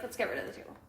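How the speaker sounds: unvoiced hushed let's get rid of it; whispered urging. Urging the boyfriend to dump the table, in a whisper. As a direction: breathe it like a secret plea.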